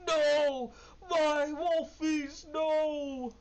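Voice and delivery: high, loud, slow anguished ogre wail. Acted. DM voices ogre grieving wolves.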